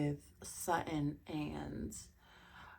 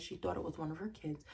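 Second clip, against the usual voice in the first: normal talking voice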